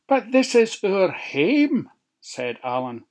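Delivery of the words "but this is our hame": worried wee pig. Acted. Alan protests, high voice.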